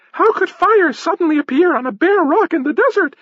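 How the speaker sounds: put-on very high falsetto girl, theatrically amazed; girl's astonished cry. A narrator's reading. Stage girl marvelling at fire, astonished falsetto cry. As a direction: pipe it up high in mock surprise. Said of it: "cried the girl".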